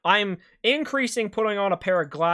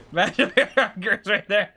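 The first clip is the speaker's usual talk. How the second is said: words spilling through laughter, higher and louder; laughing; 5 semitones higher, 7 dB louder than usual. Real, not acted. Streamer imagining the cursed run, laughing through words. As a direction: talk while still cracking up. Laughing after near disaster.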